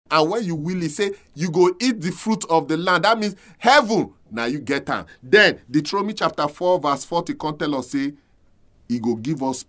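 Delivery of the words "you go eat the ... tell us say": ordinary talk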